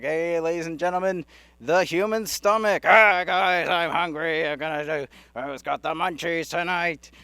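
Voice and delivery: loud showman's announcement, then easing off; stomach stage act. Acted. Stomach introduces itself at a comedy club, hammed-up announcer call. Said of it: "That would be my thing".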